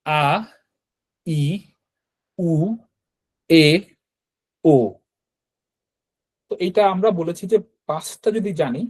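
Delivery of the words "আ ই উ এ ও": each Japanese vowel said slowly and clearly, a pause after each: আ, ই, উ, এ, ও; teacher's vowel drill. Shown as a demonstration. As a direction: model the vowels one by one for the class. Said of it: "আবার একটু বলি উচ্চারণ". (ধীরে ধীরে স্পষ্ট করে স্বরবর্ণ উচ্চারণ)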